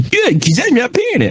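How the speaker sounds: high, huffy mock retort; grumpy customer. Customer refusing the fee, mock grumpy. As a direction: imitate a stubborn grouch having the last word. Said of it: "he sounded so grumpy".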